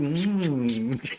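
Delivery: put-on gentle hum as if soothing a small child; cooing to a kid. Shown as a demonstration. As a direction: coo it like talking to a toddler. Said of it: "when you speak to a kid".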